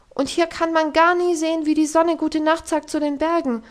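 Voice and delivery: put-on high, loud pleading child; pent-up outburst. Homesick Heidi pouring out her longing, high pleading outburst. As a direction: let everything burst out in a high voice. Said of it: "kam dem Heidi alles obenauf ... brach hervor".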